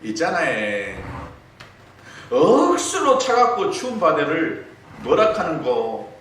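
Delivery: high, loud slow Gyeongsang dialect, playful; 경상도 사투리로 장난스럽게; Gyeongsang wife's quiz. Retold or imitated. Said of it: "그리고 아내가 이 퀴즈를 냅니다".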